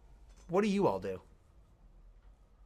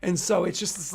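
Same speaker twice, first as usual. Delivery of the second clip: ordinary talk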